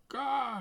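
very high, loud startled caw; crow's caw. A narrator's reading. Quark caws in protest at Tos, very high sharp caw. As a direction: let out a sharp crow cry. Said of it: "riep Quark".

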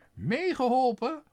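high, loud sneering question; scornful mistress. A narrator's reading. Mistress scorning the father's words.

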